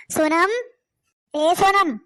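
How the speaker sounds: calling a name out across the house, high and loud. Acted. Mother-in-law summons her daughter-in-law from another room, loud call.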